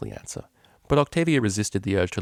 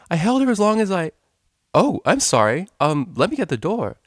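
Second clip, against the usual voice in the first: high, loud, fretful apology; a jittery pegasus blurting sorry; flustered pegasus; 10 semitones higher, 7 dB louder than usual. A narrator's reading.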